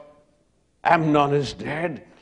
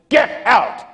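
high, loud snarl of Get out; angry shout; 8 semitones higher, 4 dB louder than usual. Acted. Amnon shouting at Tamar to leave, furious. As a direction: throw her out with a furious yell.

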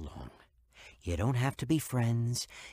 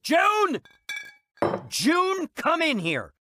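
high, loud summons from another room; angry husband yelling for his wife; bellowing; 7 dB louder, much slower than usual. Acted. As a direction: bellow her name, then the order. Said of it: "I don't answer to bellowing".

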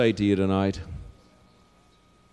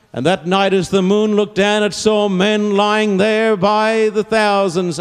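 impassioned preaching; 8 semitones higher, 5 dB louder than usual. Real, not acted. Preacher picturing the battlefield at night, impassioned preaching. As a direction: preach it out with rising force. Preacher builds to full force.

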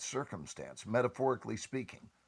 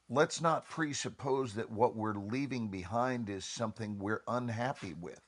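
ordinary delivery

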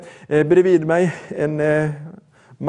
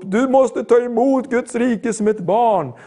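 mild mock shout, slightly raised; retold calling out; 5 semitones higher than usual. To a gym friend past a visor, mock calling out. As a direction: imitate calling across a distance without really shouting. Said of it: "Så vi ropade högljutt och rop".

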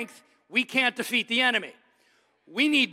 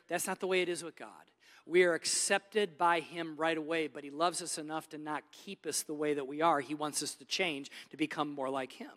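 ordinary delivery

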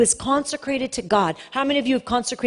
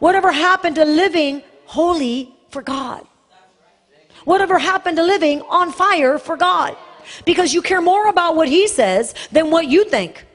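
loud, higher impassioned preaching; fiery preaching; 5 semitones higher, 4 dB louder than usual. Acted. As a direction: thunder the rebuke at the congregation. Preaching at full force.

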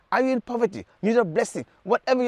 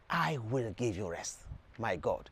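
much lower and softer, almost confiding; hushed promise; 9 semitones lower, 12 dB quieter than usual. Acted. Preacher repeating Jesus' promise to listeners, low and hushed. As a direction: let it sink to a low, soft close.

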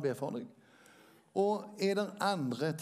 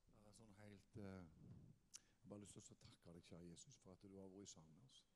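soft, low, murmured prayer; 4 semitones lower, 4 dB quieter than usual. Acted. Pastor praying over the congregation, soft and devout. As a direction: pray quietly and devoutly.

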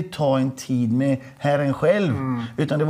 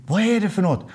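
curious question; 6 semitones higher, faster than usual. Retold or imitated. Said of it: "säger hon bredvid".

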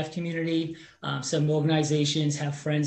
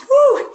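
excited whoop; 11 dB louder than usual. Retold or imitated. Told he's pre-qualified.

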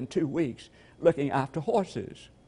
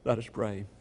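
ordinary talk

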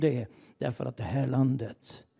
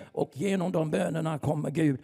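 builds from steady preaching to a loud, higher climax; rising preaching; 4 semitones higher, 4 dB louder than usual. Real, not acted. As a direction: preach harder and harder until it rings. Preaching builds to full force.

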